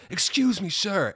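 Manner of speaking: high-pitched mock call from behind; imitated calling-out to get attention; retold yell. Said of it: "a faint yell from behind me".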